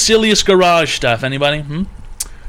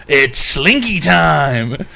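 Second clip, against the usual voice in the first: slow drawn-out dad; much slower than usual. Retold or imitated. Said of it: "He goes".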